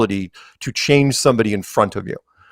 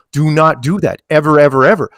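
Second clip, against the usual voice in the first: usual speaking voice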